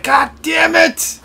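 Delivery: imitating an angry game character, high and loud; slow, high, exaggerated cursing in imitation; mock exasperated shout. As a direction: stretch out a hammed-up yell. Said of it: "He's like".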